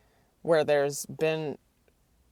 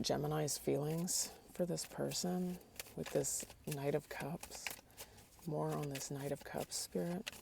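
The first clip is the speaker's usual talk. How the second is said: quiet; 12 dB quieter than usual. Real, not acted. Quietly asking spirit during reading.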